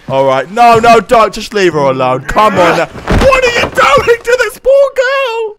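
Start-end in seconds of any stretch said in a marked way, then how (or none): 2.9-5.6 high-pitched indignant protest; high, stretched-out disbelieving protest; outraged disbelief. Real, not acted. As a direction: squeal your outrage at the bully. Outraged at the game husband.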